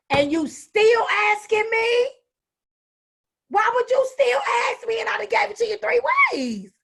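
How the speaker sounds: exasperated teacher losing patience; teacher's rising frustration, calm to shout. Retold or imitated. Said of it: "you going".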